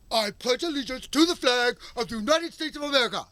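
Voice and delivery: high, loud recital of the pledge; mock emotional pledge. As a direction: chant it like an indoctrinated pupil. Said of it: "we're trained to emotionally grunt".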